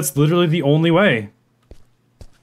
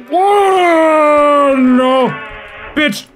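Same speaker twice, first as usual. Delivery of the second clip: much higher, much louder yelling; terrified shout; 11 semitones higher, 6 dB louder, much slower than usual. Real, not acted. Gamer ambushed by monster in game, terrified scream. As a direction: scream as the monster jumps you.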